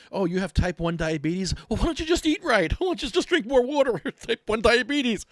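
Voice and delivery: high, loud goofy mock voice; mocking know-it-all. Vlogger mimics people who tell patients to fix diabetes, mocking. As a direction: jeer it like a clueless buffoon, laughing.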